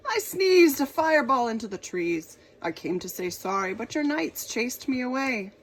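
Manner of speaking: loud, booming dragon. Acted. Dragon explaining the sneeze to the king, loud dragon voice. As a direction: boom the explanation out. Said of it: "he roared".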